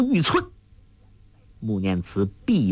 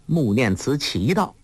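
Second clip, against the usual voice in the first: ordinary delivery, nothing marked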